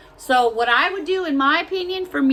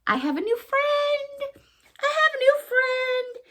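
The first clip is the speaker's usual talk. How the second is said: put-on high, sing-song baby voice for a new toy; cutesy high voice; 13 semitones higher than usual. Showing off her new gnome in a cutesy high voice. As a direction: coo it like a delighted child.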